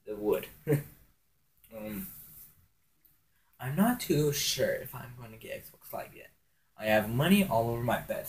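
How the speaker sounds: normal talking voice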